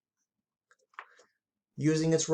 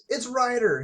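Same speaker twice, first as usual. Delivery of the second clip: excited exclamation; 7 semitones higher than usual. A narrator's reading. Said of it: "he exclaimed".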